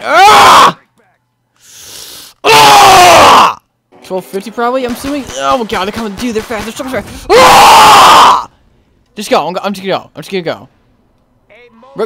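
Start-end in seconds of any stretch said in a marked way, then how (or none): 0.0-0.8 long, high yell; startled scream. Real, not acted. Gamer caught by zombies, startled scream. Startled by attack while playing. 2.4-3.6 high, drawn-out scream; startled scream. Real, not acted. Player under attack, screaming. Caught by zombies while playing. 7.3-8.5 long, high yell; panicked scream. Real, not acted. Dude, they're so fast, panicked scream.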